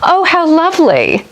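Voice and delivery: high-pitched delighted exclamation, swooping down at the end; excited delight. Retold or imitated. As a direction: coo it as if shown something exciting. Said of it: "something that I'm excited about".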